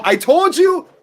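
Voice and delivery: high, loud i-told-you-so; triumphant shout. Real, not acted. Watch-along host crowing at chat over the yellow, loud and high. Yellow card after warning.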